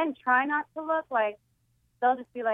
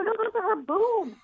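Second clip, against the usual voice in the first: high, put-on voice; deadpan it in a high voice; imitating herself; 9 semitones higher than usual. Telling Carlos about her sister's chest, high put-on voice. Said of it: "I go".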